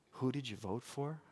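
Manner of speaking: soft, low mocking echo; mocking her question. Pastor echoing the woman's question to the congregation, mock-soft. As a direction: repeat it with mild disbelief. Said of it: "that's the question you want to ask".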